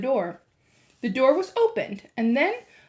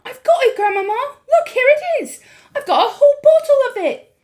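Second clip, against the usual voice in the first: loud, high triumphant shout; excited cry; 11 dB louder than usual. A narrator's reading. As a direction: burst out in triumph. Excited boy.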